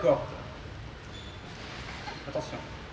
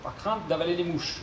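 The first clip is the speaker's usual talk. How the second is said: normal talking voice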